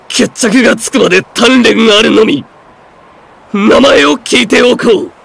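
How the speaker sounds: high, tense, forced-out declaration; strained vow. Acted. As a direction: declare rivalry while straining for composure. Hurt ninja vows a rematch.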